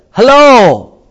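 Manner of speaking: sudden high shout of hello; bright loud hello; loud call. Shown as a demonstration. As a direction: call it out like hailing someone. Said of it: "he almost like".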